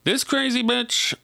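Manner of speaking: high, playful sassy delivery; sassy campy bitch. Retold or imitated. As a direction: sing it out high and teasing. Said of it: "let's pretend I'm saying it like that".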